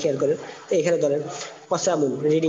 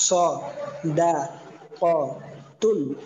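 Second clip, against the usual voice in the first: স, দা, ক, তুন as separate drawn-out syllables with pauses; broken-up teaching pronunciation, a gap after each of স, দা, ক, তুন; much slower than usual. Shown as a demonstration. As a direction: say স, দা, ক, তুন one slow piece at a time. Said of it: "প্রত্যেকটা আলাদা আলাদা".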